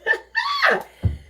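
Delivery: shrieking high laugh; high-pitched cackle at the joke. Real, not acted. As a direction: burst into a squealing laugh. Reacts to boyfriend's quote.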